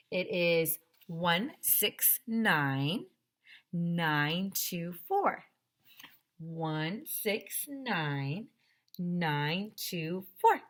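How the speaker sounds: very slow number by number; slow dictation. Shown as a demonstration. Teacher reading the quiz number to students, very slow. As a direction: dictate for kids to write down. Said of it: "give you the quiz number".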